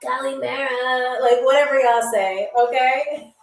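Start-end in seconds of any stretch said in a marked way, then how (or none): 0.0-1.2 slow, put-on dude greeting; man voice. Shown as a demonstration. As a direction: try out a man's voice, drawn out. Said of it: "like, a man voice".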